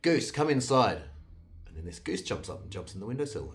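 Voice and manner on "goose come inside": loud, high, drawn-out call; slow, ringing summons; yelling out. A narrator's reading. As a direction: call the goose in from out the window. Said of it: "he yells out".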